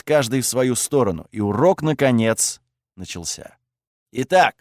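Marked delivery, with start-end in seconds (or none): 4.1-4.6 sharp barked opener. A narrator's reading. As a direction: bark it like a stern instructor. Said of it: "рявкнул Кейнич".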